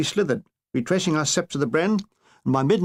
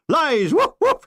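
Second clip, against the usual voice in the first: high yelping woofs between fast indignant protests; barking colonel; 10 semitones higher, 4 dB louder, slower than usual. Acted. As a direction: yap like a dog, then bluster. Colonel denies, barks.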